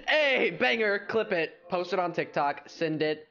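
high burst of hype, easing down; mock hype. Streamer telling chat to clip the joke, high mock hype. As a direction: call it out like a viral moment.

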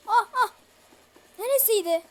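loud, high frightened gasps; startled yelps. Real, not acted. Gamer jumping at a scare, loud frightened cries. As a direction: jolt of real fright.

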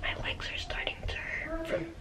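whispering. Real, not acted. Hiding, whispering.

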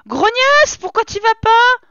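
loud, high-pitched mock outrage; over-the-top exasperated shout; violent scolding. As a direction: yell at the game character, pitch shooting up. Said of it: "je suis violente".

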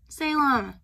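loud, high call of the name; real exasperated shout at the cat. Real, not acted.

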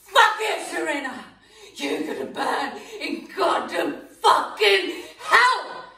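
sobbing, furious cursing; tearful fury at Serena; crying rage. Acted. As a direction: spit the insults through tears.

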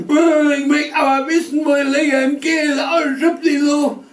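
put-on high, loud wailing boy; boy screaming. A narrator's reading. Little boy screaming at his scolding parents.

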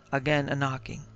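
ordinary delivery, nothing marked

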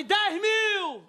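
high-pitched mock bidding call; acted auction yell; retold bid shout. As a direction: call the figure across the room.

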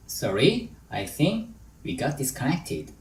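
slow drill with "Sorry", then "I think" set apart; chunked with pauses. Shown as a demonstration. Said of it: "sorry 끊고, I think 끊고".

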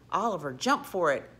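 ordinary delivery, nothing marked

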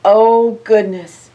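ordinary delivery, nothing marked

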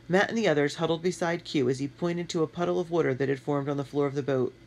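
ordinary delivery

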